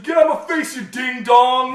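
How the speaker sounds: furious yell that ends in a silly insult; angry shout. Retold or imitated. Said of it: "really angry".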